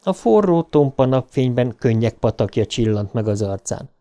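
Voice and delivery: plain reading voice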